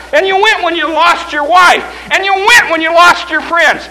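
rousing, punched-out sermon; full-force preaching. Acted. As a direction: drive each phrase home like a revival preacher.

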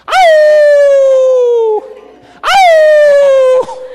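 long, loud high-pitched howl; dog howl. Acted. Dogs joining in with another, howling. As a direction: join in howling like a town dog. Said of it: "because the one dog howls".

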